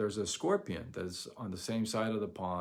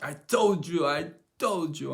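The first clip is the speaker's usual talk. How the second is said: loud, higher angry shout at the bug; angry yelling; 6 semitones higher, 8 dB louder than usual. Retold or imitated. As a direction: yell it out in fury. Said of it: "I'm like".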